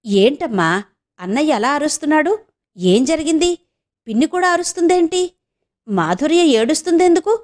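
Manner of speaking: high, urgent alarmed questions. Acted. Son hearing shouting next door, alarmed and high.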